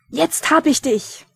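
slow, loud high roar. Acted. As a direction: roar it in triumph. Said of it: "brüllte er".

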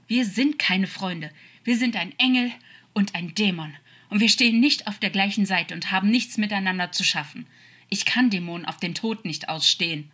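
loud, emphatic denial; angry rejection. Acted. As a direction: cut the other off with forceful contempt. Angel angrily rejects the friendship.

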